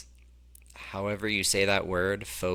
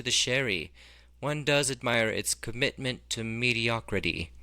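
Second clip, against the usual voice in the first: put-on snooty English accent; 2 semitones higher than usual. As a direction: sniff at it in a snobby English accent. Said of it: "I tried to do that like an English person".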